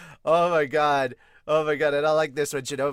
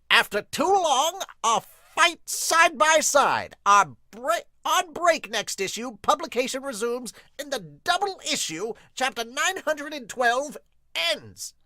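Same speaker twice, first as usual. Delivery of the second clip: much higher, louder, slow hype; much higher, louder announcer read; dramatic caption announcer; 11 semitones higher, 5 dB louder than usual. Acted. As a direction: announce it like a trailer. Reads chapter end caption.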